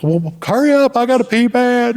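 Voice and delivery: high, loud whining demand; desperate Paul. Retold or imitated. Paul pressing the driver to hurry, high desperate whine. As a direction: squeal it like you can't hold it.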